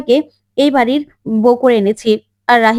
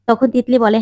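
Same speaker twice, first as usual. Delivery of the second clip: ordinary delivery, nothing marked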